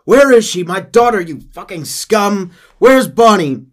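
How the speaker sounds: furious father shouting; angry shout. Acted. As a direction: roar at him. Father accusing John.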